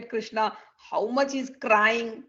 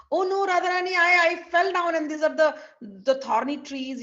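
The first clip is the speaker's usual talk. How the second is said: flustered excuse; 6 semitones higher, 5 dB louder than usual. Acted. Krishna makes excuses for marks.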